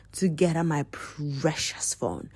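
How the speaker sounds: soft breathless whisper on precious; whispered Smiggle impression. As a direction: hiss it like a creature hoarding treasure. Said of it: "just like Smiggle".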